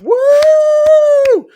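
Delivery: very high, loud cheering woo; a spectator's hammed-up cheer; crowd whoop. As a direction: whoop like a crowd breaking into applause. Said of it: "you hear this applause just break out".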